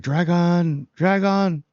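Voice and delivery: "Dragon" high, drawn out on both syllables; imitated show pronunciation. Fan copying how the characters say "Dragon", high and stretched. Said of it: "I like how they're saying".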